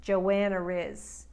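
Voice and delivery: "Joanna Riz" slowly, an R linking Joanna to "is"; intrusive R. Shown as a demonstration. Coach showing how a New Yorker links words, intrusive R in "Joanna Riz". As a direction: slip an R between "Joanna" and "is". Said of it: "might put an R in between".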